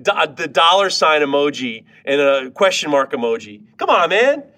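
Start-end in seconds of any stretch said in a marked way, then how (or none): 3.8-4.4 high, loud exasperated plea. Real, not acted. Host fed up with price-only commenters, exasperated high plea. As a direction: come on, man, pitched high and loud in disbelief.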